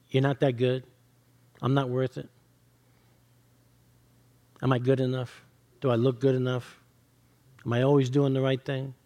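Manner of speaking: a low, understated voice of self-doubt; accusing inner voice. Acted. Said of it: "heard an accusing voice".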